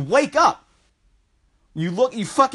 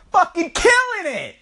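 hyped up; 9 semitones higher than usual. Real, not acted. Bragging about push-ups, fired up and high. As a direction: pump yourself up, high.

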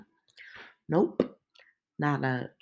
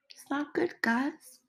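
high soft repeat; 9 semitones higher, 4 dB quieter than usual. Real, not acted. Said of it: "repeated higher, softer".

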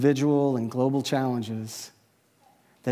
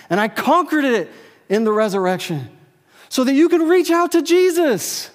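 higher, louder impassioned preaching; preaching at full force; 7 semitones higher, 5 dB louder than usual. Acted. Pastor urging the congregation with full preaching force. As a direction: lift the room toward Jesus, full fervor. Climax of sermon.

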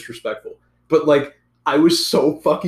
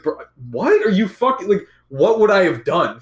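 high-pitched, incredulous questioning; exasperated disbelief; 7 semitones higher, 4 dB louder than usual. Retold or imitated. Student retelling his reply to a classmate, exasperated and incredulous. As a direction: protest in baffled, raised pitch.

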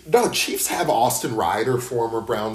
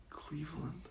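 hushed, voiceless question; mock disbelieving whisper; 7 semitones higher, 20 dB quieter than usual. Co-host acts out contestants hearing the destination, mock whisper. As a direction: whisper it as if let down. Contestants' dismayed reaction, retold.

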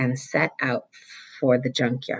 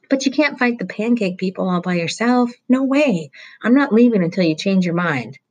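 higher, louder, stubborn pleading; insistent boy; 6 semitones higher, 3 dB louder than usual. Acted. Roy insisting he won't leave the truck, stubbornly. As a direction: dig in and refuse to go.

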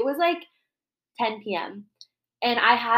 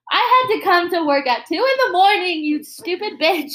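retold rant, higher and louder; angry telling-off; 6 semitones higher, 6 dB louder than usual. Retelling scolding a drunk coworker at night, angry. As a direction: chew them out, replayed for friends.

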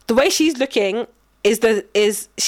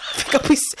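normal talking voice

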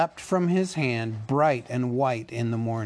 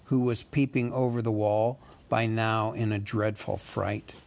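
usual speaking voice

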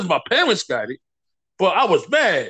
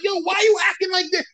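incredulous challenge; 9 semitones higher, faster than usual. Retold or imitated. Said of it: "I'm like".

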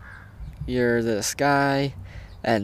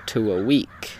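normal talking voice

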